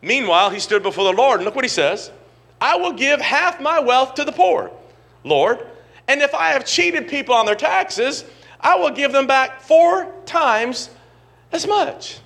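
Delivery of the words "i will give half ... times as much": high, loud, eager vow; Zacchaeus vowing. Acted. Zacchaeus vowing before the Lord, high and loud. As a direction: promise it with rising zeal.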